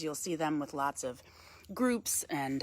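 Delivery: ordinary delivery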